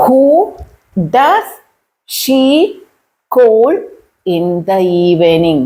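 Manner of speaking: each word set apart, rising at first; slow dictation with long gaps between words. Shown as a demonstration. Teacher showing learners how to ask, word by word.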